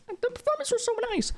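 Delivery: very high, dreamy fan voice; squeaky gushing. Real, not acted. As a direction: swoon over the performances. Gushing about performances.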